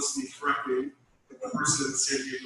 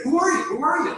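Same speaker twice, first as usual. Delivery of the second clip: higher, louder confused boss on the phone; confused demanding; 12 semitones higher, 6 dB louder than usual. Retold or imitated. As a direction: mimic the woken boss demanding who he is. Supervisor confused on phone call.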